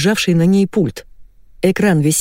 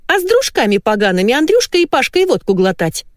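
high-pitched, nagging wife; 8 semitones higher than usual. A narrator's reading. Wife grilling husband about his drinking friends, high-pitched nagging. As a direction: keep at him in a high voice. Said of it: "не успокоилась Валентина".

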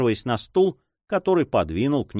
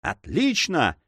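cheerful exclamation; 7 semitones higher, slower than usual. A narrator's reading. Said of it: "вскричал Филипп весело".

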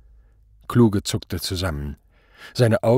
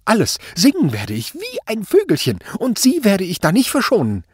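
very high, loud, hysterical outburst; blurted threat; 12 semitones higher, 5 dB louder than usual. A narrator's reading. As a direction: threaten desperately, voice shrieking upward. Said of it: "stieß Kluge hervor". (hysterisch hervorgestoßene Drohung)

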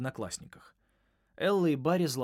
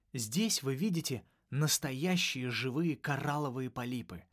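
plain reading voice

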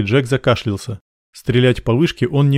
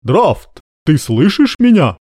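raised, higher voice with drawn-out words; calling out; 6 semitones higher, 5 dB louder, slower than usual. A narrator's reading. Major shouts for an answer from the silent enemy commander. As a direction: hail someone out of earshot.

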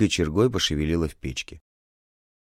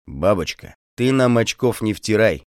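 severe, louder rebuke; 4 semitones higher, 5 dB louder than usual. Acted. Feldsher severely rebukes the woman. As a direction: warn her off, grim. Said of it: "сурово искривив рот".

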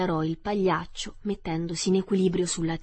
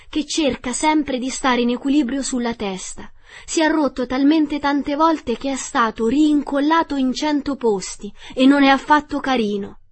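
high, loud storybook princess; lively, projected character voice; animated princess; 6 semitones higher, 7 dB louder than usual. A narrator's reading. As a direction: explain eagerly in a raised princess voice. Princess gossips about the clown.

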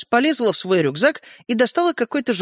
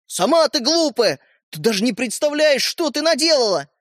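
high-pitched indignation; angry retort; 6 semitones higher than usual. A narrator's reading. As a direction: throw the insult back and accuse. Brother accuses her through quarrel. (сердито, с обвинением)

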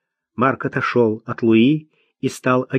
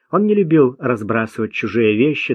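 ordinary delivery

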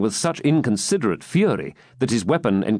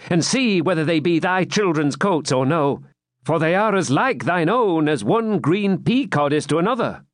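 higher, light-toned jester; 7 semitones higher than usual. A narrator's reading. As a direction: play it as the wry jester. Jester voiced in story.

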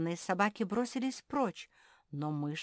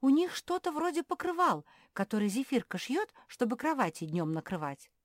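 high, louder, thrilled; excited, raised chatter; delighted wonder; 9 semitones higher, 5 dB louder than usual. A narrator's reading. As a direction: marvel at the sight. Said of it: "восхитилась Мафи".

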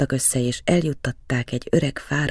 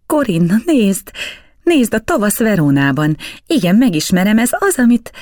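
put-on higher, louder child shouting in wonder; sharp excited cry; 9 semitones higher, 6 dB louder than usual. A narrator's reading. Boy crying out to Corinna at the painting, sharp excited shout. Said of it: "éles kiáltással".

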